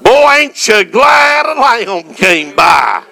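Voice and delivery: preaching. Acted. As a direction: preach it out with full fire. Preacher building to full fervor.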